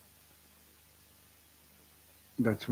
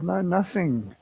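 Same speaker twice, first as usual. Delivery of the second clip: exasperated; 7 semitones higher, 4 dB louder than usual. Retold or imitated. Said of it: "I was getting a bit exasperated".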